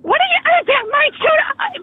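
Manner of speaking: high, spluttering indignation; outraged. Real, not acted. Insulted mother to fake airline agent, outraged. Caller outraged at parenting jab.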